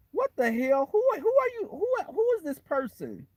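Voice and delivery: high-pitched, incredulous questioning; acted bewildered protest; baffled outburst. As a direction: stumble into high, exasperated disbelief. Acting out baffled reaction to persona.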